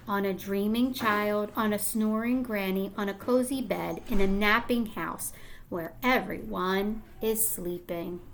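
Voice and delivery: put-on gentle storybook read-aloud to young kids; reading to children. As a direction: lull the class through the story, soft at each line end. Reading picture book aloud.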